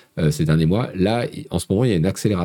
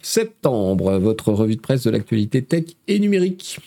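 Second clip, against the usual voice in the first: raised, projected presenter voice; show-opening announcer; 10 semitones higher than usual. Acted. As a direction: open the live show like a host, pitched well up.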